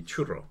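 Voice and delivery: CHURRO with "oo" and a rolled R; proper Spanish pronunciation. Shown as a demonstration. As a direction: say churro the Spanish way, CHOO-rro. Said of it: "the proper pronunciation would be".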